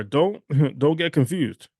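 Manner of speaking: ordinary delivery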